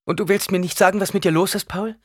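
high, urgent question; worried pleading. A narrator's reading. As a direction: press your son anxiously. Worried mother presses her son.

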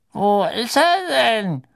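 put-on high, slow, thin elderly voice; reedy old official, puzzled; old man looking around. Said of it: "sagte die dünne Stimme des Alten".

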